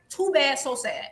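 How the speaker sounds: ordinary talk, nothing marked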